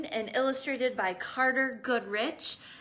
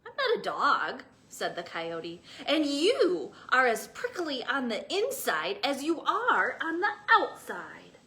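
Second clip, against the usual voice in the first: louder indignant comeback; retort; 2 semitones higher, 4 dB louder than usual. Acted. Coyote scolding the prickly cactus. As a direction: put him in his place.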